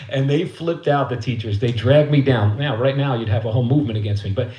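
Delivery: normal talking voice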